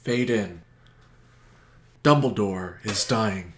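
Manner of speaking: mock dramatic narration. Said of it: "give us a summary of Endgame".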